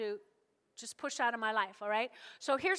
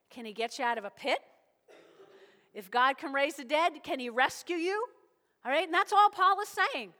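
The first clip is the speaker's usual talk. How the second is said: usual speaking voice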